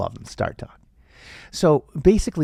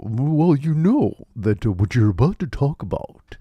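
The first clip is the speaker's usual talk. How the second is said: Neil deGrasse Tyson; 2 semitones higher than usual. Acted. Scientist interrupts the host mid-scene, pompous impression. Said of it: "What, Neil deGrasse Tyson?".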